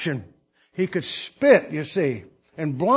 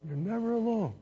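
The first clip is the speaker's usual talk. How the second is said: much softer, let it sink in; quiet repeat to the congregation; tender murmured echo of the promise; hushed echo; 3 semitones higher, 9 dB quieter than usual. Real, not acted.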